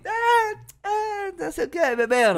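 high-pitched tipsy giggly mumble; mimicking a drunk giggle fit; imitated giggling babble. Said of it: "começou a rir".

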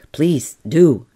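loud, punched invitation; keen, emphatic plea; eager. A narrator's reading. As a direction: urge him on emphatically. Dizzy, eager applicant answers caller.

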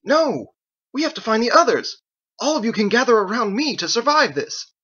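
frantic man insisting they must survive; desperate shout. Acted. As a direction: shout to rouse him. Ezekiel protests desperately.

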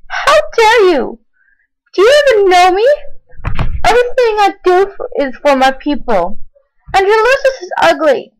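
indignant angry outburst; outraged. Acted. Princess insulted, outraged retort.

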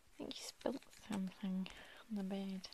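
breathy unvoiced hush; hushed whisper. Real, not acted. Parent whispering to child.

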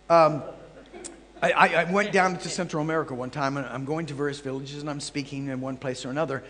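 normal talking voice

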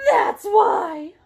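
high, loud acted wail; hammed-up tearful finish; upset child. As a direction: blurt it out as an aggrieved little kid. Sam ends his tearful explanation.

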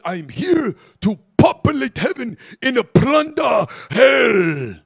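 booming, slow shout, every word punched; thunderous German-African evangelist, drawing out the last word; Reinhard Bonnke. Acted. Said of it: "He would talk like that".

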